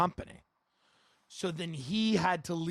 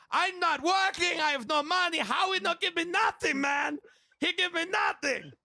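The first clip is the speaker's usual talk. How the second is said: mock upset Spanish-speaking worker; upset Eddie; 9 semitones higher than usual. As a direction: plead like a crying worker. Eddie quoted crying.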